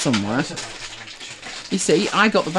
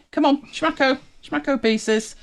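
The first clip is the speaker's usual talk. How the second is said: high coaxing voice for the dog; overwhelmed by cuteness; 9 semitones higher than usual. Real, not acted. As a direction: coax the dog, pitched way up.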